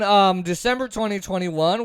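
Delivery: usual speaking voice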